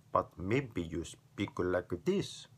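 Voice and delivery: slow, mock foreign-accented English; put-on foreign accent. Shown as a demonstration. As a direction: drag it out in an exaggerated foreign accent. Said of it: "switching between one accent or another accent".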